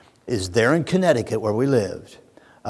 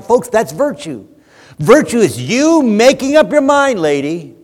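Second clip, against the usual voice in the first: much higher and louder fiery sermon; full-force preaching; 9 semitones higher, 10 dB louder than usual. Acted. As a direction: thunder it at the church.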